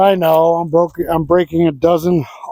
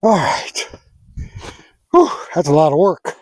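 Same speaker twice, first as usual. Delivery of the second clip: out of breath from shoveling; winded, puffing between phrases; huffing and puffing; 3 semitones lower, 3 dB quieter than usual. Real, not acted. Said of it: "I'm huffing and puffing".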